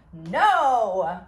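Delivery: loud, drawn-out refusal; loud protest. Real, not acted. Reader refusing the plot turn, loud protest. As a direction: cry out against it.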